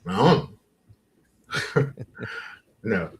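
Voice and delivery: usual speaking voice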